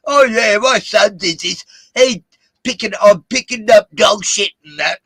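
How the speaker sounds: high, loud hammed-up fake Australian banter; mock Aussie host. Mocking a radio host's family anecdote, in a loud, high fake Australian accent. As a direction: parody an Australian radio host's chummy chat. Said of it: "they talk, try to make out they have a personal life".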